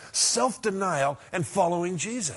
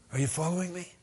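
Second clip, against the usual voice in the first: ordinary talk, nothing marked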